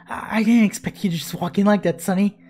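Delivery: ordinary talk, nothing marked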